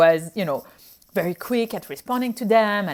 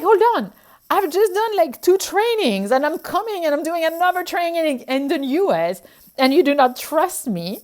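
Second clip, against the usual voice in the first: retold exasperation; 7 semitones higher, 4 dB louder than usual. Retold frustrated rant to patients.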